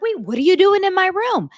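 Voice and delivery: loud, high mocking bewilderment; sarcastic imitation of a startled woman; mock confused sister. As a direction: play up her fake confusion. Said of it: "now she's like".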